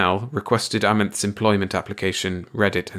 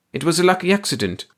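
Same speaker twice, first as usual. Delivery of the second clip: terrified; 9 semitones higher, faster than usual. A narrator's reading. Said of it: "Amenth looked terrified".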